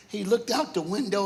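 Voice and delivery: normal talking voice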